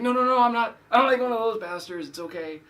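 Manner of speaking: high, loud, eager denial; a pastor quickly calming a young bartender down; reassuring protest. Retold or imitated. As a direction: hurry to reassure him you're not that kind of pastor. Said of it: "And I was like".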